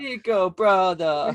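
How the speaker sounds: high mock European accent; Roman. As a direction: echo the cousin's call in a badly faked European accent. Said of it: "I can't do that European accent".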